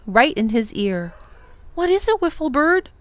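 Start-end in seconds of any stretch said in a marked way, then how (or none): 1.7-2.9 put-on higher, startled boy's voice; a boy caught off guard asking what's wrong; gasped. Tom asks the startled wiffle bird what's wrong, alarmed. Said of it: "he gasped".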